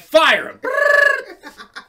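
loud high shout of "Fire!" then a high buzz; hammed-up superhero call; mock hero shout. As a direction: yell it like a cartoon hero summoning fire. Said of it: "He's like".

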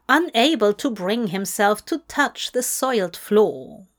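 usual speaking voice

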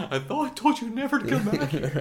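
high, loud, laughing villain line. Retold or imitated. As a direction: ham up a menacing movie quote. Quoting the film's villain line.

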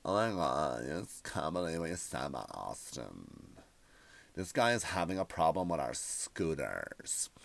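whiny tech person. Retold or imitated. Mocking imported tech people complaining about him. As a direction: sneer it like an offended newcomer. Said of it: "those imported tech people".

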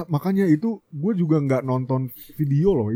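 ordinary delivery

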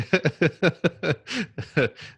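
real high-pitched laughing; amused laughter. Real, not acted. Host laughs because the point is so true, amused. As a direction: keep laughing in recognition. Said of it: "I'm just laughing".

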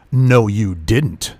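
low, drawn-out retort; slow contradiction. Retold or imitated. Customer contradicting the clerk at the counter, slow and low. Mimics her slow reply back.

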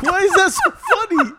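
high, loud, slow question through laughter; laughing protest. Real, not acted. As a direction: protest while cracking up. Teller protests at the others' laughter.

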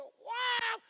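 high, loud whoop of joy; excited wow. Retold or imitated. Husband hears his wife is pregnant, excited wow. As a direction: cry out wow, thrilled. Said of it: "Y yo estaba emocionado".

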